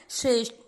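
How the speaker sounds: श्रेष्ठ with a firm hit on the ठ; श्रेष्ठ, ठ struck hard; stress on ठ. Shown as a demonstration. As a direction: strike the ठ in श्रेष्ठ. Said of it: "आघात लगाना है".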